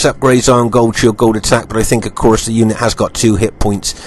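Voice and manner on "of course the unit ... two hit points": normal talking voice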